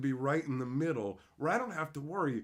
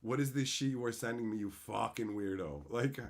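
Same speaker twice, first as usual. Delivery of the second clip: ordinary talk, nothing marked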